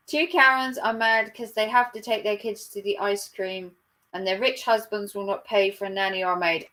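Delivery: normal talking voice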